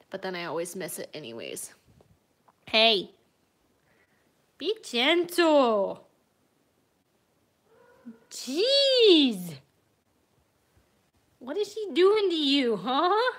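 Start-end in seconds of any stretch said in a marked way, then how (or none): 2.6-6.1 loud, slow warning to the cat; firm warning. Real, not acted. Owner calling out to her rough cat, firm and loud. Scolding cats. 8.3-13.3 exasperated exclamation. Real, not acted. Owner exclaiming at her cats, high and loud.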